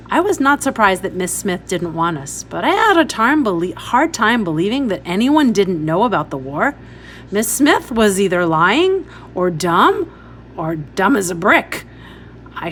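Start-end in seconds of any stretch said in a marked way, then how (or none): none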